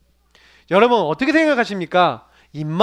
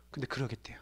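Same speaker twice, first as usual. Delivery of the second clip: breathy whisper of a reported reply; 9 semitones lower, 23 dB quieter, faster than usual. Retold or imitated. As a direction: drop to a secretive whisper. Reporting nurse's quiet agreement. (속삭이듯 조용히)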